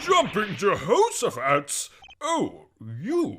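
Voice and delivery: put-on high, slow startled old man; startled exclamation. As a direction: jump out of your skin.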